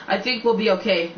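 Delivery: usual speaking voice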